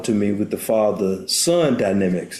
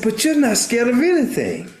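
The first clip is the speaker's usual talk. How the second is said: high, wide-eyed little cub; young Simba's voice; 11 semitones higher than usual. Retold or imitated. Cub tells his father he fears nothing, high and wondering. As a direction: pitch it up like a small boy in awe. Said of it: "he said".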